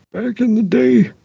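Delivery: put-on high, creaky old man voice. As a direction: talk like an old-timer. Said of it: "Very good old man voice".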